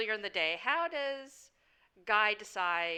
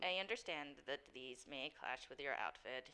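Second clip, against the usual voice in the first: soft, lower, deferential servant voice; formal doorman; 8 semitones lower, 14 dB quieter than usual. Acted. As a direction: explain it with measured courtesy. Game master plays doorman.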